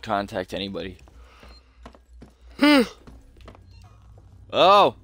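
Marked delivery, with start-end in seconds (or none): 2.6-3.1 sharp startled gasp; a sudden high gasp of shock; loud gasp. Real, not acted. Startled while playing. 4.5-4.9 high, loud startled cry. Real, not acted. Startled reaction in game.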